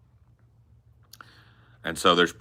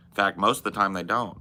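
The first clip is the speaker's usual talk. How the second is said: ordinary delivery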